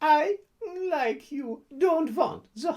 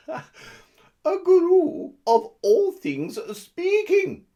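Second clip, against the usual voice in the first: put-on Russian accent; 4 semitones higher, 6 dB louder than usual. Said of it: "he said in her Russian accent".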